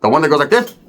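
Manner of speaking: ordinary talk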